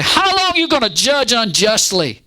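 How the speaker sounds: bold, high-pitched accusing cry; gutsy challenge. Acted. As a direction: demand an answer as if staring God down. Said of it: "gutsy enough to stare down God".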